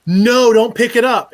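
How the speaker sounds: loud, high, drawn-out yell of no; yelled warning. Retold or imitated. To the cook reaching for the bottle, a loud yell. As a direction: shout to stop him grabbing it. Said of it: "they heard me yell".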